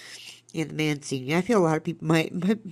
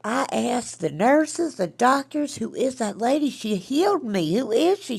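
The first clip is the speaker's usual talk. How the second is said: imitating granny's country accent, higher and louder; 8 semitones higher, 5 dB louder than usual. Granny retells her healing, in a country accent. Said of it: "that's how she talked".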